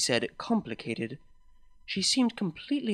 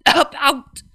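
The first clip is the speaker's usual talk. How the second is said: gasped out, much higher and louder, between coughs; 11 semitones higher, 11 dB louder than usual. Acted. Sick mare gasps to her girlfriend, loud breathless gasp. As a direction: force it out on a breath after coughing. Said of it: "I gasped".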